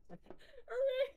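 high sing-song cheer. Real, not acted. Reaching safe space.

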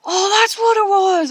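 excited realisation, shouted aloud; sudden realisation. Retold or imitated. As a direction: burst out as it finally clicks. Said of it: "I was, like, suddenly like".

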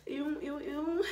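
high squeals of disgust. Real, not acted. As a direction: recoil from the smell.

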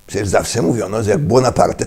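normal talking voice

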